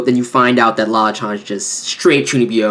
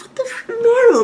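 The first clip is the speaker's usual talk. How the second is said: high exasperation. Real, not acted. Exasperated outburst at character.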